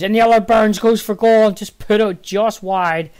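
high, loud play-by-play burst; excited shot call. Real, not acted. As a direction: call the near miss with rising urgency. Commentator reacts to a near miss.

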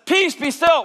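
high and loud command; commanding call. Acted. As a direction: proclaim it with full authority. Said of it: "He says".

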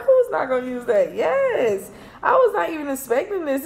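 excited. Real, not acted. Thrilled by surprise gift card.

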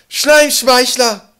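very high, slow, ringing shout; loud desperate call. A narrator's reading. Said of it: "Laut drang seine Stimme".

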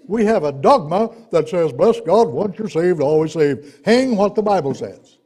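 loud pulpit denunciation; forceful preaching. Real, not acted. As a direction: thunder it at the congregation without raising the pitch. Preaches against a dogma at full force.

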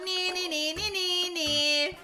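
teasing nee nee chant; taunting singsong. Acted. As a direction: taunt like a kid. Taunting chaser in game.

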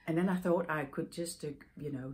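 normal talking voice

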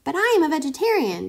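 high and fast, declaring it; high declaring guinea pig. A narrator's reading. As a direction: announce it in a quick squeak. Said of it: "declared Kai".

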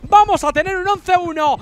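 loud, high excited caster call; excited shouting. Real, not acted. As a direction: shout the play as your team wins. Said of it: "lo estoy celebrando".

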